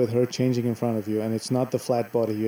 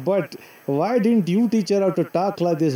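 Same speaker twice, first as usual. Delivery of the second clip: put-on Indian accent, pitched up at the start; 6 semitones higher than usual. Shown as a demonstration. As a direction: tease in a playful Indian accent. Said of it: "I can't speak that Indian accent".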